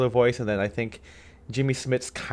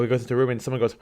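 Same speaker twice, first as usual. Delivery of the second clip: ordinary talk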